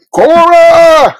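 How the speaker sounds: high, ringing call of a name across a distance. Retold or imitated. Calling to a character on the show, a high ringing shout. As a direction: yell the name out high like calling someone far off.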